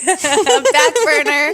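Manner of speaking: laughing loudly through the words. Real, not acted. Echoing friend, laughing.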